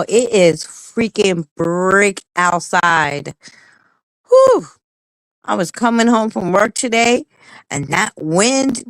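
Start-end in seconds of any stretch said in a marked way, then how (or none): 4.3-4.7 sharp high exhale of relief; a shrill shaken whew from the cold; high loud whew. Real, not acted. Reacting to freezing cold.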